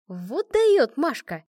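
impressed, drawn-out exclamation; admiring. A narrator's reading. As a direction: stretch it out in admiration. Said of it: "восхищённо сказала Аня".

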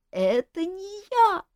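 high, slow, laughing protest; too amused to defend himself; moaning with laughter. Acted. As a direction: jester weak with laughter. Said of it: "помирающий со смеху Шут".

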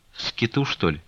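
soft, broken-hearted question; a touch of a broken voice. A narrator's reading. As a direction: ask weakly, as if crushed. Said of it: "спросила Аксинья Захаровна разбитым голосом".

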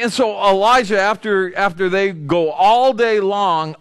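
ordinary talk, nothing marked

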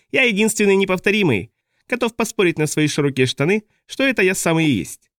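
manic high clown voice; asylum patient. A narrator's reading. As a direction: boast like an unhinged clown. Boza clown voice.

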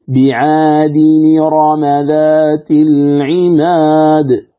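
"بعاد نرم ذات العماد" very slow on a level pitch; slow chant-like model, no hamza; correct linked recitation. Shown as a demonstration. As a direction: draw out each long vowel, linking as bi-'aadi-ni-rama. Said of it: "فيقول".

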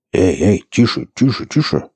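repeated hushing, pitch climbing; mildly alarmed placating; urgent soothing. A narrator's reading. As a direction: try to calm someone dangerous, urgently. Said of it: "начал приговаривать Борланд, делая успокаивающее движение".

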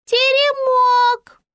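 put-on very high, loud, stretched child's call; high piping child; thin little voice. A narrator's reading. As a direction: pipe it out in a tiny squeaky voice. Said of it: "повторил из занавески тоненький голосок".

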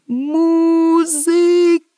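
high, loud, long-drawn wail; desperate cry. Acted. Emperor screams for music, desperate cry. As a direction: cry out, stretching both vowels. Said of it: "кричав імператор".

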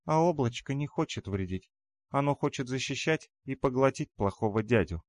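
высокий голосок малышки-защитницы; говори тонко, по-детски; детский голос демонёнка. A narrator's reading. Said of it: "произносит мне в куртку демонёнок". (тоненький голосок маленькой девочки)

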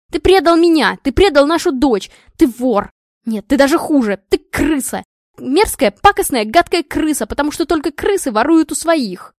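high, loud enraged tirade; furious screaming. A narrator's reading. Wife berating husband over lost money, furious screaming. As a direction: scream the insults at him. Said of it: "орала она в гневе".

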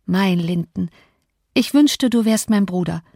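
ordinary delivery

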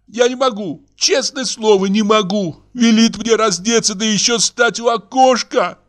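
high-pitched, distressed woman; theatrical feminine indignation; tearful protesting wife. Acted. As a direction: refuse in a high, upset voice.

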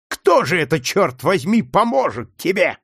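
contemptuous question. A narrator's reading. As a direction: scoff at his faith. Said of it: "презрительно спросил Легри".

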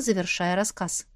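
plain reading voice

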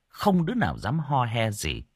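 usual speaking voice

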